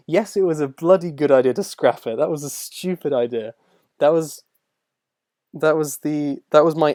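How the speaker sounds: emphatic verdict. Real, not acted. Streamer answering a viewer about a scrapped idea, emphatic. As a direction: punch it out with conviction.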